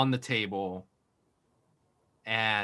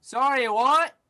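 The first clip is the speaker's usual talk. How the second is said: loud innkeeper voice; 10 semitones higher, 5 dB louder than usual. Acted. Steve mishears, loud.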